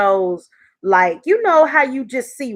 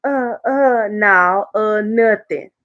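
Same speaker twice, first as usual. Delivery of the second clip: mock stammer; slower than usual. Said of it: "come back and say".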